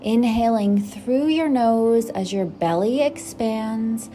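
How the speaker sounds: gentle, unhurried meditation guide voice; guided meditation. Acted. Host guiding listeners into breathing, calm meditation voice. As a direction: settle the listener into stillness.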